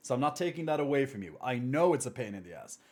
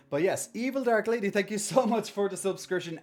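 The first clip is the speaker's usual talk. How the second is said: high, louder cheerful thank-you; cheery thanks; 8 semitones higher, 5 dB louder than usual. Real, not acted. Thanking Evil Dark Lady for her subscription, cheery and high. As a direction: beam at the subscriber.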